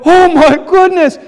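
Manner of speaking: a hammed-up gasp at the sky; mock astonishment. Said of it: "And they go".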